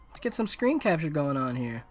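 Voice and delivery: usual speaking voice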